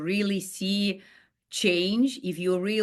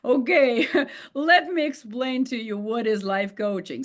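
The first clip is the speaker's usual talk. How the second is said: bright, louder explaining voice with a laugh; patient coach; 5 semitones higher, 3 dB louder than usual. Retold or imitated. Coach to doubter: bright, patient, laughing. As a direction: let me explain, she laughs it off.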